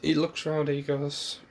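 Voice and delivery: usual speaking voice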